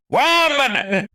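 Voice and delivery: high loud cry; scared yell; startled shout. Acted. Shout from basement.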